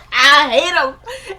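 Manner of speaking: high, loud laughing outburst. Real, not acted. Recapper on the character she hates, laughing. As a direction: stretch the words out through real laughter. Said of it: "makes me laugh".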